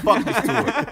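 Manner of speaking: high-pitched, laughing dismissal; mock exclamation. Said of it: "He was like".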